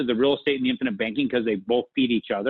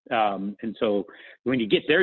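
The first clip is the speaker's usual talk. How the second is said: normal talking voice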